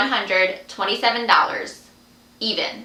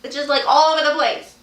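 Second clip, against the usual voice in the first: sheepish apology; 4 semitones higher, faster than usual. Real, not acted. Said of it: "I'm sorry".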